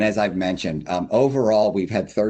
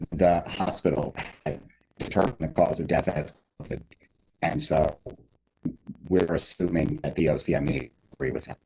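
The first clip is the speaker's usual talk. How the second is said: muffled, like through a wall; bad connection, muffled voice; muffled audio; 3 semitones lower than usual. Real, not acted. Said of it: "[name], you sound muffled".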